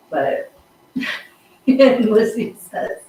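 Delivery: ordinary talk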